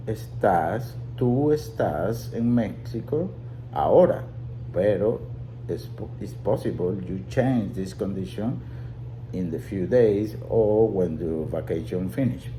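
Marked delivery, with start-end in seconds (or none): none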